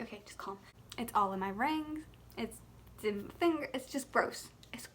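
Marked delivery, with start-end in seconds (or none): 0.0-0.6 whispered self-calming; whispering. Retold or imitated. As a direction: whisper it to calm yourself. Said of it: "So I'm like".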